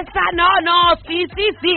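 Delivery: high, loud panicked no no; husband pleads to save clothes; frantic pleading. Retold or imitated. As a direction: beg in a rush. Husband begging over clothes.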